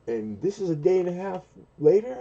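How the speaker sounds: high, disbelieving question; mock incredulous. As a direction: stress how odd the timing is. Retold confrontation.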